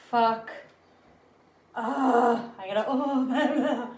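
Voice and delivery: loud, panicky wail; panicking. Real, not acted. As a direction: wail in nervous panic, half laughing. Carrying trapped bee, panicking.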